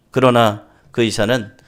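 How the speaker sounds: usual speaking voice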